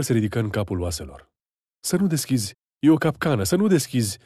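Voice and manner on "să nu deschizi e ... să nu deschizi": high urgent pleading, stressing "nu"; pleading wife. Acted. Wife begging her husband not to open, high urgent plea. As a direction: beg him not to open, pushing "nu". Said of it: "îl imploră nevastă sa".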